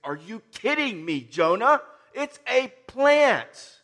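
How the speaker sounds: loud, incredulous, dragging out plant slowly; mock the absurdity of sulking over a plant; exasperated disbelief. Preacher scolding pouty Jonah, loud and incredulous. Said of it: "It's like".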